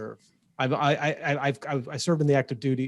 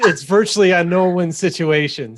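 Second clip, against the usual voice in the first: playful loud lament; 4 semitones higher, 8 dB louder than usual. Real, not acted. Jokey complaint after a tough act.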